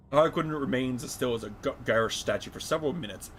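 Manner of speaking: ordinary talk, nothing marked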